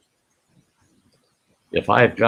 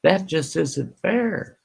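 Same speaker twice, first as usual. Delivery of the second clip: slow, much higher whine on "fair"; mock whiny complaint; 8 semitones higher, slower than usual. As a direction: mimic a complainer. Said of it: "when it comes to people saying".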